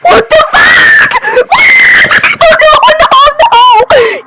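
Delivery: panicked screaming at a jump scare; scared scream. Real, not acted. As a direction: scream in real fright. Jump scare in horror game.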